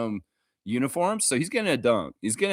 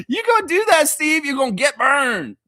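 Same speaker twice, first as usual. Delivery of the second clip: higher, louder mocking taunt; teasing taunt; 13 semitones higher, 6 dB louder than usual. Streamer taunts friend Steve about his bet, playful mocking. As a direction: rub it in playfully.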